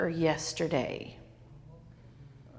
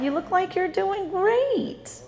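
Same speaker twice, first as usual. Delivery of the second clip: mock gushing relative; 8 semitones higher, slower than usual. Mocking relatives again.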